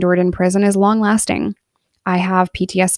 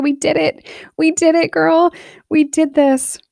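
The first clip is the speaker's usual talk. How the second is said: high-pitched retold joy without shouting; overjoyed, high-pitched, reenacted; happy, tearful; 8 semitones higher than usual. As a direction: relive telling your child you won, voice lifted high. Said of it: "hugging her and crying. And I'm so excited".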